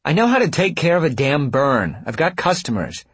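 high, loud irritated retort; exasperated, pitch up; annoyed protest. A narrator's reading. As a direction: protest crossly while being pulled away. Protesting as he pulls her along.

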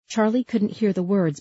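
usual speaking voice

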